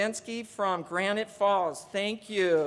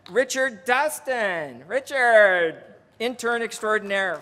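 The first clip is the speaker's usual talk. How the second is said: calling out; 7 dB louder than usual. Real, not acted. Calling out to the intern.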